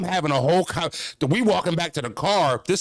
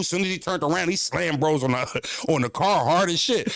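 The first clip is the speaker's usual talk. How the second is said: normal talking voice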